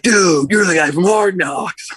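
imitating the stoned guy. Stoned stranger recognizing him in the park, slow and spaced out. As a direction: play the tripping stranger, drawn out. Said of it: "he's like".

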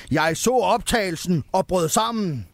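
high, mock-sobbing Santa; hammed-up tearful complaint, pitched high. A narrator's reading. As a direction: put on a mild wail as Santa. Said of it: "sagde julemanden hulkende".

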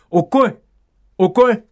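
おっこい said as okkoi, with a clipped double k; おっこい in Kagoshima dialect, okkoi. Shown as a demonstration. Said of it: "こういう発音だそうです".

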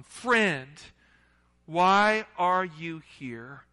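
loud, very slow, drawn-out plea to a friend; slow pleading call. Acted. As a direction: stretch out each word like a wounded plea. Jesus pleading with Judas.

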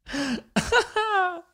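high-pitched helpless laughter; long squeaky real laugh; high laughing fit. Real, not acted. Helpless laughter at own joke.

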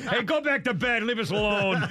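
laughing tease. Real, not acted.

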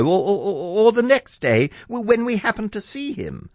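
high, stammering little voice; timid Piglet. A narrator's reading. Piglet suggests going home without giving in, timidly. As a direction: hedge nervously, stumbling over words. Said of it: "and a little timidly, because he didn't want Pooh to think".

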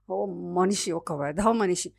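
plain reading voice

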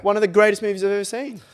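defending the Joker passionately; indignant excitement. Real, not acted. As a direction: insist it with fervor.

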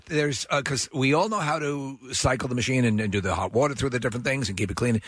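normal talking voice

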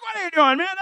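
high, exasperated big brother; annoyed big brother. Retold or imitated. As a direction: snap it at the little brother. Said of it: "you're like".

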